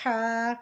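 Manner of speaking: ہا from mid-throat with a stream of air. Shown as a demonstration. Teacher showing the articulation point, ہا from mid-throat. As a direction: push cool air through ہا, then hold the vowel. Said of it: "حلق کے بیچ والے حصے سے".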